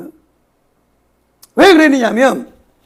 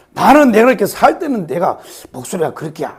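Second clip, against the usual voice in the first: normal talking voice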